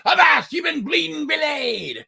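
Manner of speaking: hammed-up pirate bellow. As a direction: roar it like a captain. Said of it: "you bellow".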